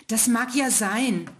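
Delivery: hohe Kinderstimme, langsam gedehnt; kleiner Stern, zögernd. Acted. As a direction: nachdenklich einräumend als kleiner Stern. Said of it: "antwortete der kleine Stern". (kleiner Stern gibt dem ältesten Stern zögernd recht)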